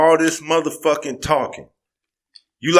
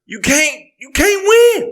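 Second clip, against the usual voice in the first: rising outburst; 11 semitones higher than usual. Real, not acted. Heated argument over violence.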